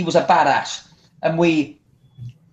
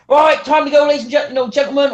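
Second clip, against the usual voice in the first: calling out closing time; 7 semitones higher than usual. Retold or imitated. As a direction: sing it out through the toilet doors.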